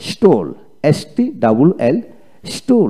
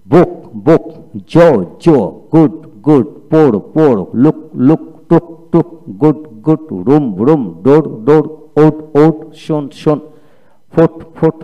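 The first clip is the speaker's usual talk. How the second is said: reading drill. Shown as a demonstration. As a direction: call out every word twice for the class. Teacher reads drill words twice.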